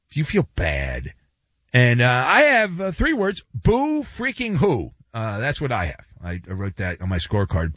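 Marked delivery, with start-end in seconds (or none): 3.5-4.9 higher, drawn-out BOO freaking who; mocking pity. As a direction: draw out Boo freaking who in mock sympathy. First, mocking take of repeated phrase.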